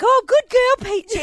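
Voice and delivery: high, loud dog-praising coo; doting praise. Retold or imitated. Owner praises Peaches before another owner, doting. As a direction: gush over your good dog.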